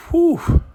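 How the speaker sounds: high, loud exhale of relief; relieved whew. Retold or imitated. Recapper voicing a man after a close call, relieved whew.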